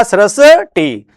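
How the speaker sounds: सृष्टि said slowly and clearly as "srishti"; teacher modelling सृष्टि slowly; slow model pronunciation. Shown as a demonstration. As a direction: stretch out सृष्टि for the class. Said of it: "तो ये क्या बनेगा?".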